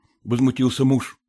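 ordinary delivery

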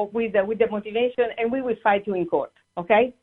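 ordinary talk

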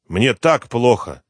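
he can barely bear it; weak complaint. A narrator's reading. Sick father to detective, slow and suffering.

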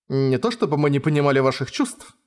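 ordinary delivery

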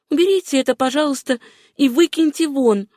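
high-pitched, insistent request; woman ordering the maid. A narrator's reading. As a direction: send the maid off with it. Ordering maid to remove filthy rags.

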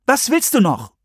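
high, impatient question; irritated girl, pitched high; impatient demand. A narrator's reading. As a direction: throw it back, fed up with him. Girl turns round, hands on hips.

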